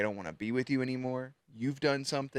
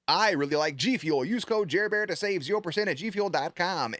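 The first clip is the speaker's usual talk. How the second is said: mock ad read; 7 semitones higher, 3 dB louder than usual. Mock sponsor plug.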